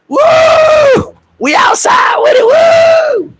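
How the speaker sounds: very high, loud hammed-up whooping; mock party cheer. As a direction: yell it like drunk at a party. Imitating partying status.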